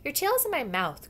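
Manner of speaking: high, loud youngster's complaint; crying out. A narrator's reading. Young animal squashed against a tail, crying out. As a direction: cry out in protest. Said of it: "cried one of the youths".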